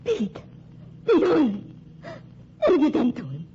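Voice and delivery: high, loud horrified outburst; anguished cry. Acted. Betty cries over Pete's body and accuses Renfron, horrified. Finds lover dead, accuses.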